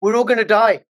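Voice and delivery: hammed-up alarm, not in earnest; mock alarm. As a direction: play at panicking about impending doom. Joins in the sarcasm, mock doom.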